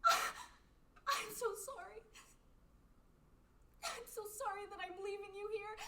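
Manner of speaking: slow, high, crying whisper of sorry; tearful apology. Acted. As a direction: weep out the apology. Apologizing to dying friend, crying.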